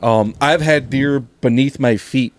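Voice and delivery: ordinary delivery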